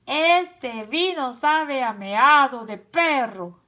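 slow, loud, emphatic outrage; wolf's shout. A narrator's reading. Tío Lobo disgusted by the wine, slow outraged shout. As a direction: cry out, stretching each key word. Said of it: "al momento gritó".

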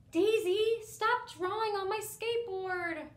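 put-on a kid's furious yell; angry yell at sister. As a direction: yell it at your little sister. Brother yelling at sister.

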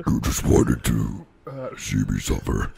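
mock sinister villain; evil whisper. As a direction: hiss it like a villain. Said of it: "That's what he sounds like".

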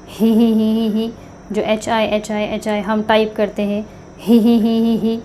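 quiet breathy ही ही like a small laugh; soft giggle he he. Shown as a demonstration. Teacher imitating a light laugh for the ह sound. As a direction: giggle ही ही softly to show the ह. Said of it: "वैसी ही आवाज़ निकालनी है".

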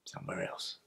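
barely audible, voiceless murmur; hushed reverie. Acted. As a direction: drift away into a whisper. Drifting off into reverie.

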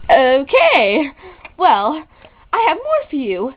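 ordinary talk, nothing marked

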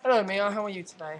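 copied upbeat greeting. Shown as a demonstration. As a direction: copy the friend's hyped delivery.

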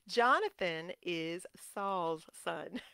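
slow, deliberate, word by word; careful correction. Real, not acted. Preacher correcting a repeated slip, slowly and carefully. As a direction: spell it out slowly to get it right.

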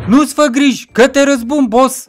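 high, loud character voice; avenging friend. Acted. Friend promises revenge.

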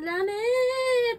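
high, very slow drawn-out mock wail of "ラメー"; playful imitation of a friend yelling "ラメー"; imitating a loud cry. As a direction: stretch "ラメー" out long and high like a hammed-up yell. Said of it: "めっちゃ大声で言ってた".

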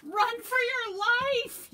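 high, very loud, drawn-out yell; panicked yell. A narrator's reading. Rabbit flees the pond monster, panicked yell. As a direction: scream the warning at full force. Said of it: "yelled Rabbit".

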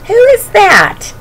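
high, surprised little bear voice; a startled cub's squeak of a question; lightly voiced baby bear, puzzled; bear cub asking. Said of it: "he asked".

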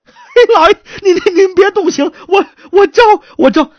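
high, loud stammering plea; panicked surrender. Acted. Suspect begging not to be tortured, panicked. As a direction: blurt out surrender in terror.

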